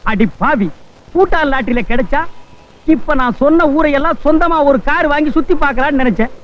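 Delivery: normal talking voice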